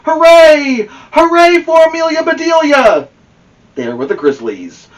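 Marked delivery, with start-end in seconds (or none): 0.0-3.1 loud, high crowd cheer. Acted. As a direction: roar with joy for her. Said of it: "she heard a loud roar".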